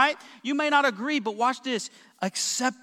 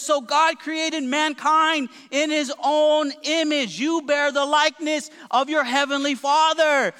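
higher, slow, ringing scripture proclamation; full-force preaching; 5 semitones higher, 4 dB louder than usual. Real, not acted. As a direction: proclaim it to the whole congregation.

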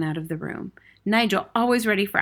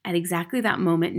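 ordinary delivery, nothing marked

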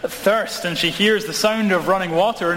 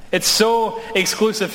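a higher "It's so exclusive" with "so" popped up; mock indignation; 4 semitones higher than usual. Pastor echoing the critics, higher and mock-outraged. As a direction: repeat the complaint, pitched up and exasperated. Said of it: "I've been told that's exactly the problem".

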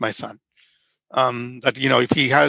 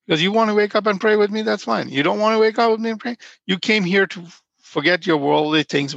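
Swami's voice; 7 semitones higher than usual. Retold or imitated. Voicing the Swami's easygoing words.

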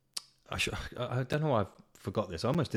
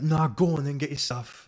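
imitation roadman, higher and louder; pushy roadman; 8 semitones higher, 6 dB louder than usual. Rude man to passenger blocking the aisle, pushy roadman voice. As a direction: mimic a brusque lad, raised and loud.

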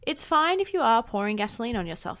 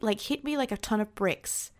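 normal talking voice

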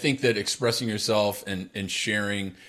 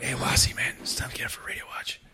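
quick, whispered nudge to a sleeper; hushed wake-up; 3 semitones higher, 5 dB quieter, faster than usual. Retold or imitated. Soldier waking his partner for radio watch, hushed whisper. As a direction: imitate softly rousing a teammate.

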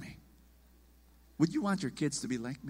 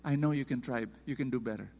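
softer, calm encouragement; soft, gentle parents; 6 semitones lower, 6 dB quieter, faster than usual. Retold or imitated. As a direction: quietly reassure your son. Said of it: "They just said".

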